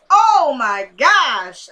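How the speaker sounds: loud, drawn-out exclamation; real fed-up cry to the viewers; exasperated outburst. Real, not acted. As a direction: let it burst out slowly and loudly. Outburst at character believing the lie.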